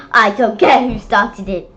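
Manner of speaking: loud, fed-up teacher scolding; angry teacher. Acted. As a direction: end the fight firmly. Teacher breaking up food fight.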